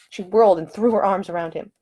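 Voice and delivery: plain reading voice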